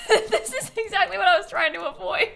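giggling through the words; laughing. Real, not acted. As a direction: laugh at the mess. Laughing at disaster.

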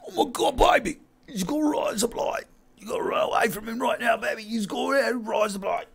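high-pitched panicky Aussie; Australian accent. Acted. Warning baby about a razor blade, high panicked Australian accent. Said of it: "in an Australian accent".

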